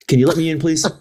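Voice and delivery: high, loud coaxing plea. Retold or imitated. Lieutenant at the car window, begging to get in. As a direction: beg him through the window. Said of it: "I was like".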